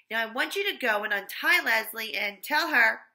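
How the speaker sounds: loud commanding voice; stern order. A narrator's reading. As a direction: boss him sternly. Louis orders Terrence.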